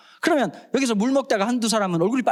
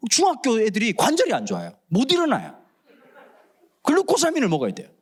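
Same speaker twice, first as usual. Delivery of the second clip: normal talking voice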